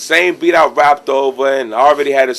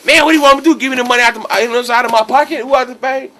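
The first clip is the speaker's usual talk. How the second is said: imitating exasperated retort; 10 semitones higher, faster than usual. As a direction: throw his annoyance back at you.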